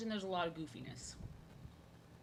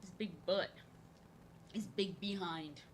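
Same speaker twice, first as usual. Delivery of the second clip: normal talking voice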